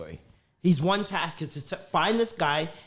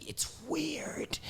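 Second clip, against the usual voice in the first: whispered aside; 10 semitones higher, 12 dB quieter than usual. Real, not acted.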